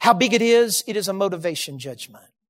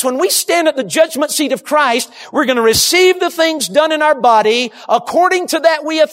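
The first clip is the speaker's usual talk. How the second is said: ordinary delivery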